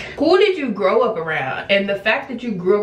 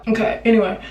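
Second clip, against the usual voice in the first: usual speaking voice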